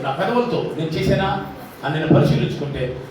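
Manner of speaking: usual speaking voice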